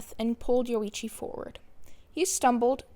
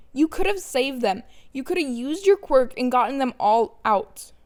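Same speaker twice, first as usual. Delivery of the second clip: loud, raw yelling, higher pitch; screaming accusation; 3 semitones higher, 9 dB louder than usual. Acted. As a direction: keep screaming the blame at him. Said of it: "Izuku screamed".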